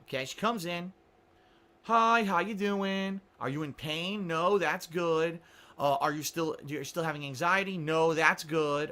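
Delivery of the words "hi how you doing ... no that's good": slow, drawn-out counselor voice; slow patronizing counselor. Retold or imitated. Mimicking the elderly counselor, slow and patronizing. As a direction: stretch every word like talking to a child.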